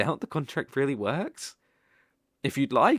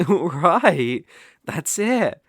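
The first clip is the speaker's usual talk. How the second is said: delighted laugh; 3 semitones higher, 8 dB louder, much slower than usual. Acted. Laughing delight after vow.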